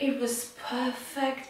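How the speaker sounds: high, put-on blissful voice; mock bliss. Pretending to viewers that birth felt wonderful, mock bliss. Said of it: "I'm not gonna lie and tell you".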